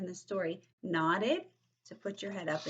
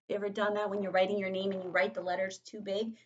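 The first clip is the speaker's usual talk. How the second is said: plain reading voice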